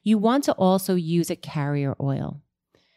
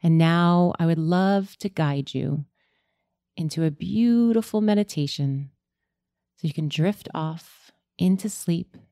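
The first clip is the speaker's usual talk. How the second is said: sleep story narrator; 4 dB quieter than usual. Acted. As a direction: guide them gently into sleep. Leads a sleep meditation.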